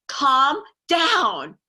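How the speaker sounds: calm down. Retold or imitated. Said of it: "the person talking to them goes".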